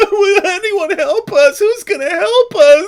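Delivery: very high, loud mock wailing; crybaby whine. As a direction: switch to sobbing crybaby. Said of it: "and then we go".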